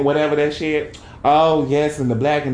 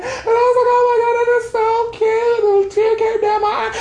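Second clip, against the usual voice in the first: very high squeaky mock weeping; tearful gushing; 3 dB louder than usual. Telling listeners about her friend's love story, tearful high squeal. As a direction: re-enact being moved to happy tears in a tiny high voice. Acts out being moved to tears.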